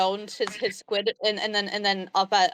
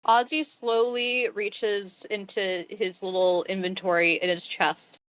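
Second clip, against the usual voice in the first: normal talking voice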